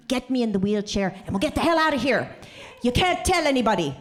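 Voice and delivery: retold urgent father. Dad plots his escape from hospital with his child, urgent and fast.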